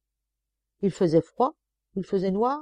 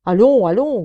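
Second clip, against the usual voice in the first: loud, higher gruff bark; growled order; 4 semitones higher, 12 dB louder than usual. Acted. Sergeant hurrying the prisoner along, loud growl. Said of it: "grogna l'adjudant".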